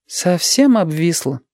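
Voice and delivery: high, drawn-out grumble at oneself; disapproving mutter. A narrator's reading. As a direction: say it slowly to yourself, disapproving. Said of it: "неодобрительно пробормотала она".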